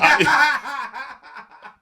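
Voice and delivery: big high burst of laughter; loud amused laugh; hearty laugh. Real, not acted. Laughs at own story.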